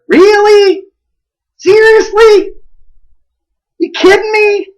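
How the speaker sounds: high-pitched, disbelieving questions; incredulous tone. Shown as a demonstration. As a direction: squeal it in mock disbelief. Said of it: "change the meaning of a word with our tone of voice".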